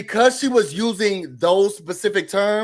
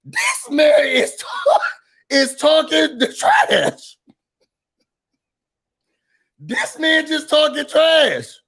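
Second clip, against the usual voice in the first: high, slow, shouted mock outrage; mock outraged listener; 7 semitones higher, slower than usual. Imitating Spanish speakers calling out the nonsense, high mock shout. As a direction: pretend to be the offended Spanish speakers.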